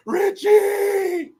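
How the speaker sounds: mock cry of a name, very high and loud; high shouted call. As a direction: holler it way up high. Calls out a name in imitation.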